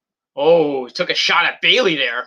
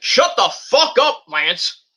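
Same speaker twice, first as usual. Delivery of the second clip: mock angry snap; 7 semitones higher, much slower than usual. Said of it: "Sting's like".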